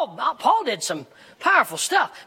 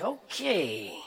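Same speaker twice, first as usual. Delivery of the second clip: very quiet, drawn-out doubtful okay; low, hesitant mock agreement; unconvinced listener; 4 semitones lower, 9 dB quieter than usual. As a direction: imitate a sceptical congregation under your breath. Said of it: "Some of you are looking at me like".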